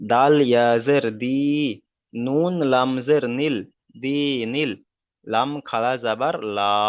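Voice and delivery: slow, measured Arabic letter-by-letter recitation; spelling drill. Shown as a demonstration. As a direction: spell it out deliberately for learners to follow. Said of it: "অনুশীলন করে দিচ্ছি". (ধীরে ধীরে আরবি বানান করে পড়া)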